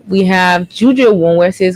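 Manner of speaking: normal talking voice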